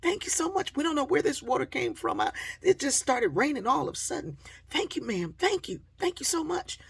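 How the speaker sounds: put-on very high, loud, grateful kids; excited, high-pitched thank-yous; polite, gushing thanks. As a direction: imitate youngsters bursting with gratitude. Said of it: "They were so polite".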